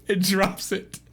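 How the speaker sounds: ordinary talk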